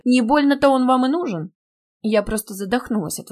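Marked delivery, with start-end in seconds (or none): 0.1-1.5 higher, louder taunting quote; gloating, pointed question; mocking echo. A narrator's reading. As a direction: throw the words back at the student. Dean throws her words back.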